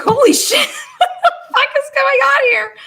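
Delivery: high, loud, laughing through the words; laughing in disbelief. Retold or imitated. Stunned guest in a sex club, laughing out loud. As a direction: burst out laughing mid-curse. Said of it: "And I just bust out laughing".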